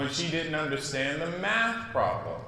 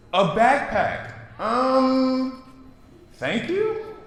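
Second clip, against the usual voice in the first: doubtful thanks; 9 semitones higher, 4 dB louder, much slower than usual. Acted. Maya unsure of gift.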